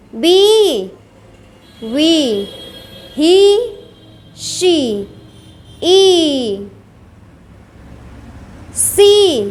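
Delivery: "बी, वी, ही, शी, ई, सी" each on a long-held ee. Shown as a demonstration. Teacher drilling the big ee, "बी, वी, ही, शी, ई, सी" drawn out. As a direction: stretch every ee in "बी, वी, ही, शी, ई, सी". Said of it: "बड़ी ई उच्चारण".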